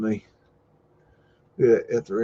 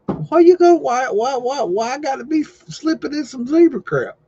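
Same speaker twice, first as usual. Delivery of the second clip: much higher whining voice; squeaky whine; 14 semitones higher, 4 dB louder than usual. Pretend. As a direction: whine it like a wounded cartoon. Whiny complaint in put-on voice.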